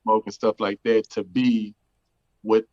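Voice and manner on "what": normal talking voice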